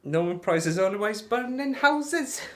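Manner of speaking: high, slow cartoon Welsh; mock Welsh accent. Streamer griping about Norman Price, mock Welsh accent. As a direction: draw it out in rising comic Welsh. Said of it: "That's just taking the mick".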